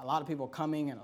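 ordinary talk, nothing marked

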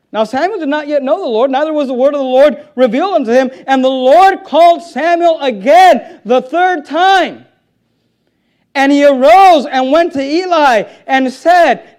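building, ringing pulpit delivery; forceful scripture reading. Acted. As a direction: read scripture out at full force. Reads scripture at full force.

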